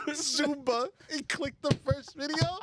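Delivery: high-pitched, drawn-out telling through laughter; laughing. Real, not acted. Confessing the Zumba dance to friends, laughing hard. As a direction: barely hold it together, voice climbing. Cracking up at own story.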